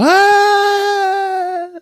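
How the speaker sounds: high, long mock scream; cartoon falling scream. Heroes falling through the floor, a high mock scream. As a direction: let out a silly falling scream.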